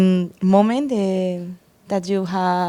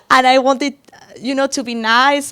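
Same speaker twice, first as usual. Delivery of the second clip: usual speaking voice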